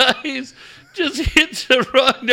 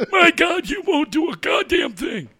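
put-on high laughing old man retort; old caller; 6 semitones higher than usual. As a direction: scoff like a cranky old fan.

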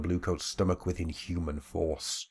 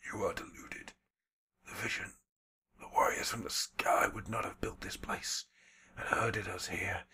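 put-on hushed, breathy old man's voice, defiant but broken; a beaten elder whispering a prophecy; whispering old shaman; 8 dB quieter than usual. Old shaman whispers defiance to the bluecoats beating him.

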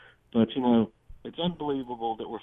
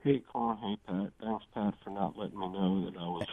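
inside a fishbowl; 11 dB quieter than usual. Real, not acted. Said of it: "You sound like you're in a fishbowl".

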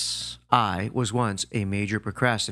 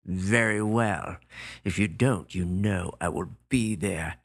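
menacing gorilla; 4 semitones lower than usual. Retold or imitated. Gorilla's warning on phone.

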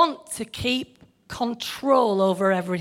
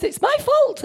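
mocking whine; 13 semitones higher than usual. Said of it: "Riley, you know, and a few others".

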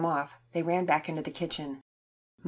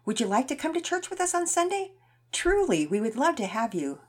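sweet invitation; 7 semitones higher than usual. Acted. Mama Peters inviting sweetly.

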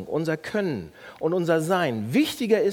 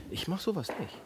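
much softer, self-satisfied; smug aside; 10 dB quieter than usual. Shown as a demonstration. Pastor voicing the smug inner voice. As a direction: murmur it smugly to yourself. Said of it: "Merkt ihr das?".

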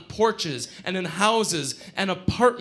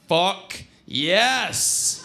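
triumphant cheer; 3 semitones higher than usual. Acted. Friend celebrating as cop cars arrive, triumphant. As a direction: punch out FUCK, then let YES soar.

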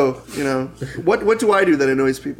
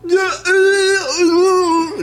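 much higher, wailing nonsense cry; silly outburst voice; 3 dB louder, much slower than usual. Shown as a demonstration. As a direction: blurt it out in a goofy wail.

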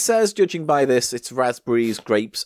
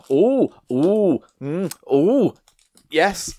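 excited; 4 semitones higher, 5 dB louder, much slower than usual. Real, not acted. Excited about the sweet.

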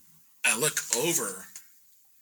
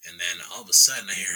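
ordinary talk, nothing marked

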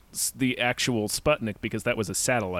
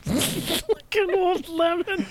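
very high, slow squeaky echo; mock posh echo; much slower than usual. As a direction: repeat it in a silly high voice. Echoing a funny line.

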